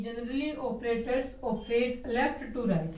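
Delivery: very slow, word by word, for writing down; slow dictation. Shown as a demonstration. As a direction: dictate it slowly so students can copy. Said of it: "property है ये operator की".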